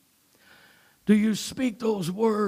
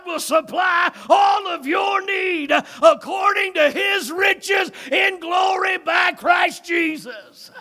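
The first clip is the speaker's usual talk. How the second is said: shouted high and loud preaching; preaching at full force; 11 semitones higher, 7 dB louder than usual. Real, not acted. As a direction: preach it out at the top of the voice.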